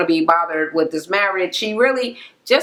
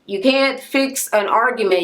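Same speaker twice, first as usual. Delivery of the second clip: normal talking voice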